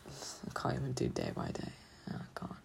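a broken, whispered admission; defeated whisper. Real, not acted. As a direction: whisper it, at the end of her rope. Admitting she can't cope.

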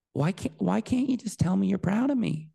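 put-on high, plaintive child's plea; pleading. Child at the bottom of the stairs to dad, pleading. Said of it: "just pleading".

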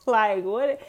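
loud, baffled what; exasperated. Real, not acted. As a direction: throw up your hands. Exasperated rant at students.

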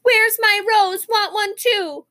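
high, slow demanding whine; drawn-out, high-pitched childish demand; hollering child. A narrator's reading. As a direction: holler like a little girl left out. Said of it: "But Jenny hollered".

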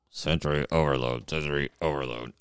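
monotone robot with quiet delivery; mock robotic announcement, held back in volume. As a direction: imitate a computerized speech synthesizer. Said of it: "I think I sounded too much like Stephen Hawking there".

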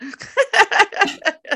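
loud burst of high laughter. Real, not acted. Client laughing hard at the coach's joke.

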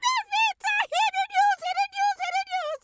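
high-pitched, loud calling across the yard; a mother shouting her news up high. Acted.

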